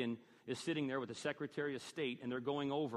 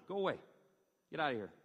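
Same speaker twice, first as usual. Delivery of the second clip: acted brusque brush-off with raised voice; stern dismissal; faster than usual. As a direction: play a guard ordering someone away. Guards turn soldier away in story.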